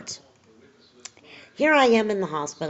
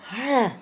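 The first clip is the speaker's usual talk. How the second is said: a long real yawn-sigh; 5 semitones higher than usual. Real, not acted. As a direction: let a tired yawn escape mid-sentence. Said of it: "excuse me, as I yawn".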